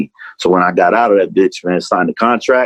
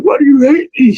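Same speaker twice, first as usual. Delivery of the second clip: high, loud, tearful wail imitating himself; retold crying plea; 4 dB louder than usual. On the phone to his brother, a loud high imitated crying plea. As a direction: replay the sobbing cry, pitched way up. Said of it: "I'm crying".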